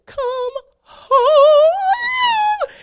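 loud, high mock wail stretched out; crying. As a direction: bawl it with the last word drawn out. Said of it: "crying, and I'm in traffic".

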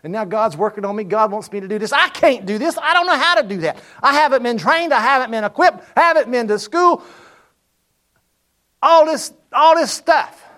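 high, loud, rising preacher's build; preaching at full force. Acted. Preacher recalling his fear to the congregation, full preaching voice. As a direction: climb and ring out like a revival preacher.

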